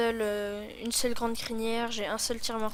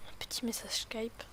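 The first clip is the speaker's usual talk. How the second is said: whispered aside, unvoiced; 9 dB quieter than usual. Real, not acted. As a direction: breathe it as a quick aside. Whispered aside about a message. (chuchoté en aparté)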